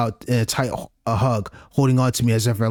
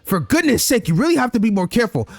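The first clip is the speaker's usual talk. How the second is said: put-on high, brisk scolding girl; 7 semitones higher than usual. Iz scolding Shinku for being careless, high. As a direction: chide him, light and high.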